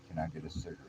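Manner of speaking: softly retold tentative ask; retold quiet request. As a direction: ask it softly, almost to yourself. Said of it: "said it kinda silently".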